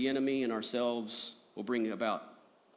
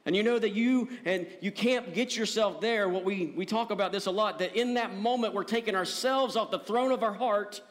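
higher-pitched, driving sermon delivery; impassioned preaching; 8 semitones higher, 5 dB louder than usual. Real, not acted. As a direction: preach it with rising urgency and conviction. Preaches at full force.

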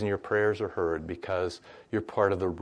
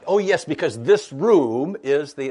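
mock delighted; 6 semitones higher, 8 dB louder, slower than usual. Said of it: "You should say".

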